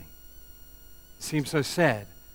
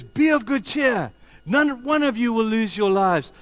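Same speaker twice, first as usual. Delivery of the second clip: high, loud preacher's proclamation; rousing shout; 10 semitones higher, 9 dB louder than usual. Retold or imitated. Paul rallying the ship's crew, rousing shout.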